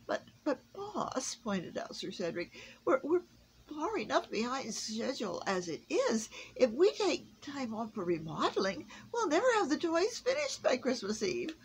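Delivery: high, bright-toned elf reporting for duty; eager elf. A narrator's reading. Elf objects to Santa.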